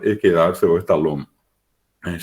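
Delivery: ordinary talk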